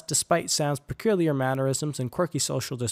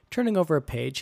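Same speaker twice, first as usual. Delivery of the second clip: ordinary delivery, nothing marked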